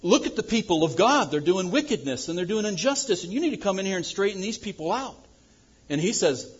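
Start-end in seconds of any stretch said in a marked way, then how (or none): 0.0-5.2 high, urgent appeal; exasperated plea, higher, the end rushed; prophet's complaint. Retold or imitated. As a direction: demand God step in. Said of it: "Habakkuk says".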